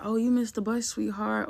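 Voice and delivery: high, gentle pretend concern; sweet mom voice. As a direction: sing it like a caring mother. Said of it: "Not a".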